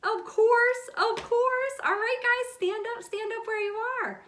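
high and loud, bouncy cheer; rally the kids to their feet with big energy; excited. Acted. Teacher excitedly getting kids up for octopus dancing.

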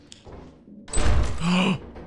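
sudden loud gasp; loud frightened gasp. Real, not acted. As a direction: gasp in terror. Creature above them.

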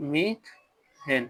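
Methane stretched very slowly; slow demo. Shown as a demonstration. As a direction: draw out methane for the class. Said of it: "এটা হলো একার". (খুব ধীরে methane)

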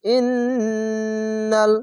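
ইন্নাল chanted with a long hummed n; held nasal ghunnah. Shown as a demonstration. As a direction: hold the doubled n of ইন্নাল through the nose. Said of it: "এখানে ওয়াজিব গুন্না".

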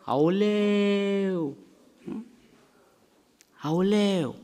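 the body groaning aoleu in complaint; a long, pained lament; body crying out. Retold or imitated. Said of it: "Să strige ce?".

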